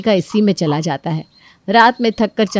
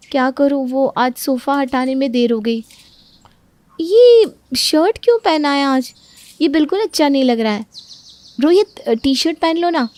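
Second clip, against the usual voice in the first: higher, coaxing wife; fussing young wife; 6 semitones higher than usual. Acted. Wife fussing over husband's shirt, coaxing. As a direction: coax him to change shirt.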